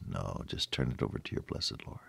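soft hushed whisper; preacher answering his own question in a whisper. Real, not acted. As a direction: confide it in a whisper.

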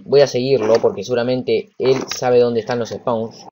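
normal talking voice

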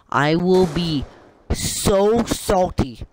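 high, slow, stretched-out words; exaggerated, stretched salty complaint; drawn-out declaration. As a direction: draw out each word for mock drama. Repeats line, first exaggerated then usual.

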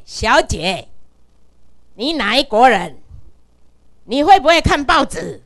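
模仿外国人大声骂回来; loud scolding. Retold or imitated. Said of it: "伊偌大声甲我骂转来呢". (外国记者在电话里大声骂她)

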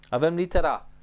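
normal talking voice

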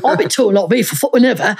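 ordinary delivery

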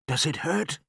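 put-on high child's voice, questioning; worried boy. A narrator's reading. Son asking about the broken ankle, worried boy's voice. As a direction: ask like a concerned young son.

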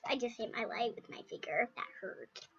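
soft breathless whisper, no voice; pained whisper. Real, not acted. Kid whispering after hurting her finger. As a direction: hush it, wincing.

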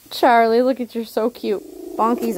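normal talking voice

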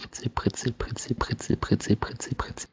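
whispered fizzing drink; fizzy soda whisper. Acted. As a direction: hiss it like bubbles.

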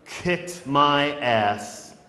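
very slow, each word set apart; drawn-out punchline. Shown as a demonstration. Poet confesses a childhood beating to the audience, slow and deliberate. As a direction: stretch every word out slowly. Punchline of recited poem, stretched out.

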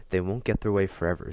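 usual speaking voice

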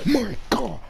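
sudden high-pitched oh my God; shocked at the monster's attack; shocked cry. Real, not acted. Shock at a big hit.

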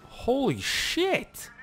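high, slow drawn-out holy shit; startled exclaim. Real, not acted. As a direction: caught off guard. Startled by the game.

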